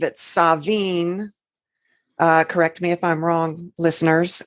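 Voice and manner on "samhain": "Samhain" said sah-VEEN, with a v; Scottish pronunciation sah-VEEN. Shown as a demonstration. Host giving listeners Scotland's version, "Samhain" as sah-VEEN. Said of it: "or in Scotland, I believe".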